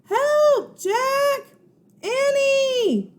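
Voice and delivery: high, loud shout for help; high shouted cry for help. Acted. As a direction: scream from far away for help. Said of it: "Her cry seemed fainter".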